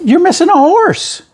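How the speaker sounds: spring the joke on him with a grin in the voice; gleeful high-pitched tease; playful punchline. Retold or imitated. Teasing punchline to the cowboy.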